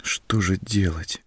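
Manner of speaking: медленная, растерянная мольба к себе; спрашивай себя медленно, почти плача; едва не со слезами. A narrator's reading. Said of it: "едва не со слезами". (чуть не плача, медленно)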